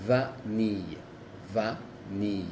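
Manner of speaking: vanille with a plain a and clear n; French vanille, no nasal; French vanille, not nasal. Shown as a demonstration. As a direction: vanille as va-ni, n sounded. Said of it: "se dice la A normal y la N".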